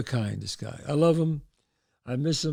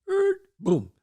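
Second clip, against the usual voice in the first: door creak imitation. Storyteller mimics the upstairs door opening, creak and boom. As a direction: voice a door creaking open and banging.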